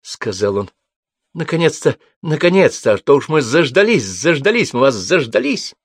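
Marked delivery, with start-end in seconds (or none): 1.3-5.7 high, loud, gushing host greeting; a loud high host fussing over late guests; gushing welcome. Acted. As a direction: pour out an overjoyed welcome.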